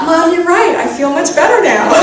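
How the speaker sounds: loud and high, laughing through it; giddy laughing exclamation; laughing mock relief. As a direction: cheer it while cracking up. Laughing mock reply to mom.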